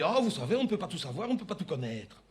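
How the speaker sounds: put-on soft, low agent with a faint African accent; hint at an African French accent, unruffled; lightly accented, softly placating African agent; slight southern accent. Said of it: "je détecte tout à coup comme une légère pointe d'accent".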